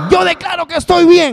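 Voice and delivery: high, insistent mock declaring; mock believer. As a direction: play someone forcing themselves to say they're fine. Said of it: "voy a decir".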